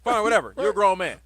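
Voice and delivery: loud, fed-up giving in; exasperated. Retold or imitated. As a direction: throw up your hands, loudly. Giving up arguing.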